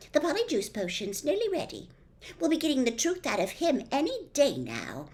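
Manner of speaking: eager schoolgirl, lightly done; lightly voiced Hermione. Narrator voicing Hermione.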